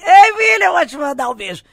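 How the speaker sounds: grita alto e animada pro diretor; chamando alto. Retold or imitated. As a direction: chama de longe, toda empolgada. (grito animado chamando)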